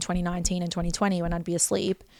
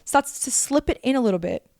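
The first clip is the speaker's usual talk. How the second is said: ordinary talk, nothing marked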